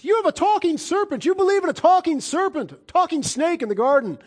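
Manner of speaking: high, sneering mock of a scoffer. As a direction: jeer it like someone ridiculing the faith. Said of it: "Satan's like to mock a talking serpent".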